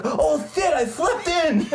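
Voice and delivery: high lisping voice through laughter. Retold or imitated. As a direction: lisp it out, giggling. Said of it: "he's got, like, a lisp".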